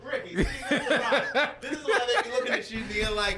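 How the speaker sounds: talking through laughter; laughing. Real, not acted. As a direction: tease him while laughing. Teasing friend, laughing heard.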